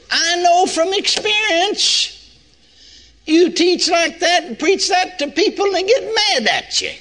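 preaching fervour. Real, not acted. Preacher rising to emphatic point.

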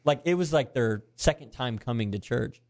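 ordinary delivery

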